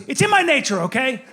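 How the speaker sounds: put-on defensive young son. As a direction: excuse yourself like a cornered child. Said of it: "He goes".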